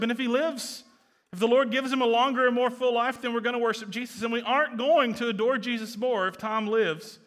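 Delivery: loud, high, impassioned preaching; fervent sermon climax; preaching at full force. Acted. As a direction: drive it home to the congregation.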